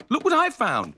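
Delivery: high, excited squeak; Scampy's squeaky voice. A narrator's reading. Scampy squeaks to Sooty holding lozenges. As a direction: squeak with glee. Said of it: "said Scampy".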